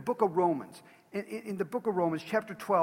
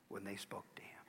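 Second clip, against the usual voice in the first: hushed reading; 8 semitones lower, 16 dB quieter than usual. A narrator's reading. Reading Joseph wept, voice drops.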